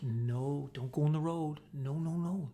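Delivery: hushed, gentle telling-off fading to a whisper; soft scolding. Retold or imitated. Owner petting the dog, softly warning it off the road. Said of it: "I was petting her, and I was like".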